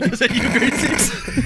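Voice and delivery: high squealing laugh through words. Real, not acted. Laughing repeat of the question.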